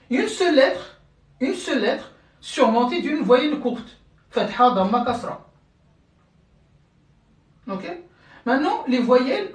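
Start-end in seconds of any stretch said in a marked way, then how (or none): none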